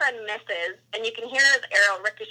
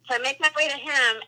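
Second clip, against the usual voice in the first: ordinary talk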